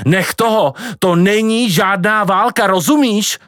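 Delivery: shrieking. A narrator's reading. Grandma still yelling at grandpa.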